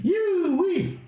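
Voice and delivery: very high whooping exclaim; yoo-wee. Real, not acted. Man marvelling at Dublin's seagulls, whooping high. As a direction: whoop it out in disbelief.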